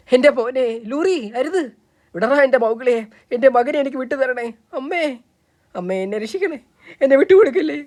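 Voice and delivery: desperate pleading. Acted. Mother and child beg the ape.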